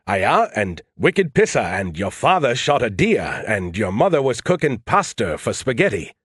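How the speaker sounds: mocking imitation of a Maine accent. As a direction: lay on the dropped-R Maine speech, mimicking. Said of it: "you were all like".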